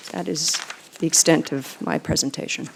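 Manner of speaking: ordinary talk, nothing marked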